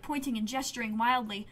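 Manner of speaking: ordinary delivery, nothing marked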